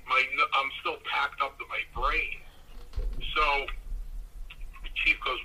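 ordinary delivery

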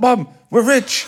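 high, drawn-out mock jubilation; overjoyed announcement. Young footballer phones mum about his first paycheck, overjoyed. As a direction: re-enact breaking huge news, pitched right up.

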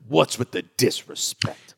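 slow, drawn-out sports-debate outrage, lightly done; Stephen A voice. Retold or imitated. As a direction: stretch out the disrespect like a TV pundit. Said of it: "Stephen A voice".

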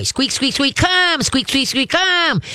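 high squeaks, then a high bright "come"; squeaky toy imitation. Shown as a demonstration. Trainer squeaking a toy to get the dog's attention. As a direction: mimic a squeaker toy between calls. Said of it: "then squeak the toy".

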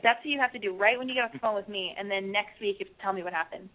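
ordinary talk

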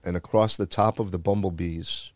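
normal talking voice

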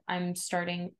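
ordinary talk, nothing marked

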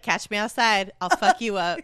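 hammed-up tough-guy threat; threatening moose. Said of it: "the moose is like".

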